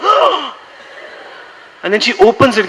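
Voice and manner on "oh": very high gasped "Oh!"; over-the-top astonished "Oh!"; mock gasp of surprise. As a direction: ham up the soap heroine's shock.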